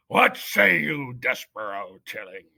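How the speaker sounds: slow, weighty judge's voice; pompous mouse judge addressing the accused; solemn head mouse judge. Acted. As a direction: pronounce each word like law. Judge asks for answer.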